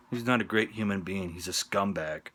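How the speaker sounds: usual speaking voice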